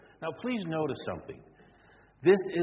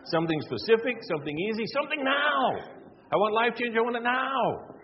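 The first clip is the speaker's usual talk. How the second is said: louder, higher and impatient; preacher voicing the impatient seeker, louder and higher; demanding want-it-now voice; 9 semitones higher, 5 dB louder than usual. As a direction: grab at it like a spoiled customer.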